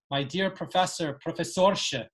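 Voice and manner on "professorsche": Professorsche ending in a clear sh sound. Shown as a demonstration. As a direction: land on the sche of Professorsche. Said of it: "with that sh ending".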